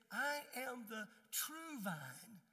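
"I am the true vine" slowly, each word weighted; slow, firm stress through "I am the true vine"; emphatic. Acted. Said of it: "Jesus said it emphatically".

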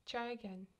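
normal talking voice

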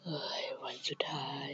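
hushed tense whisper. Real, not acted. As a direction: keep it to a breath, hiding. Last day, hiding from Granny. (กระซิบตื่นเต้นว่าวันสุดท้ายแล้ว)

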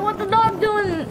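ordinary delivery

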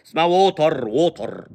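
ووتر as "wo-tar", hard t and rolled r; heavy Arabic emphatic t in ووتر; hard mispronunciation. Acted. Mocker telling him to toughen up, hard mispronunciation. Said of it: "بالطه".

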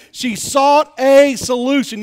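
booming slow emphasis on sought a solution; preached punchline. Shown as a demonstration. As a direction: hammer it home to the congregation.

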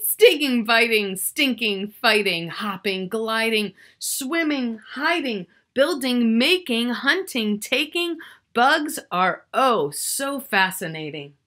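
loud, bouncy rhyming list; energetic picture-book readout; reading to children. Acted. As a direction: chant the list with gusto for the kids. Reads the rhyming recap list.

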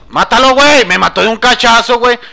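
very loud, high scream; furious scream. Real, not acted. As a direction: scream at the screen, outraged. Killed by melee, screaming.

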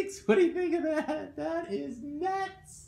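high silly pet-talk voice. Pretend.

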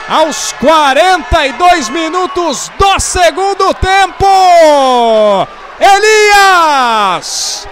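loud drawn-out goal call; goal scream. Real, not acted. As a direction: scream the equaliser, full voice.

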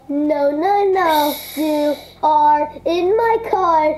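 ordinary talk, nothing marked

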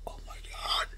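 a stunned, breathy whisper; horrified whisper. Acted. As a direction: whisper it in shock at what you did. Izuku hears Bakugo's injuries.

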